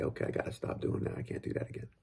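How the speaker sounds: whispered, soft voicing of a kid's thought; child's inner voice. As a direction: whisper it like a child telling himself. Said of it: "they say".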